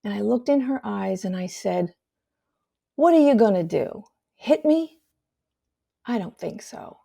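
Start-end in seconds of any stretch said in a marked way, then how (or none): 3.0-7.0 retold defiant comeback; defiant challenge. Confronts mother raising hand to hit.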